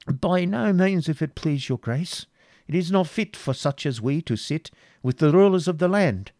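high-pitched, deferential voice; humble supplicant. A narrator's reading. Isaac declining a seat among the rulers, high and humble. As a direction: beg off meekly in a high voice. Humble man pleads with prince.

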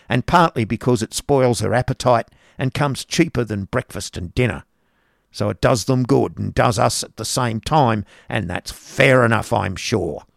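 loud, cross schoolmaster's wife; Mrs Squeers grumbling. Acted. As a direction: grumble at the cupboard. Mrs Squeers lectures new teacher.

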